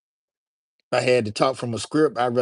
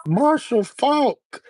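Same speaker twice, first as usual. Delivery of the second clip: much higher, loud, very slow drawn-out announcer call; announcer call impression; 12 semitones higher, 4 dB louder, much slower than usual. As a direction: stretch the name out like a hyped highlight announcer.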